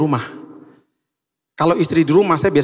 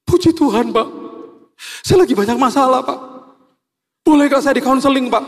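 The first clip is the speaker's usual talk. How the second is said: high, wailing imitation of a tearful man; mock crying; 12 semitones higher, 5 dB louder than usual. Driver begs the pastor for counseling, hammed-up tearful wail. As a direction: plead through exaggerated tears, pitched way up. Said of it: "Terus dia tiba-tiba nangis".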